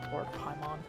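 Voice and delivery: usual speaking voice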